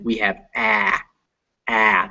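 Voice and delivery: flat front ah in ah, ah; ah vowel demo. Shown as a demonstration. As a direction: stretch the ah as in cat. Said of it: "This is a very English sound".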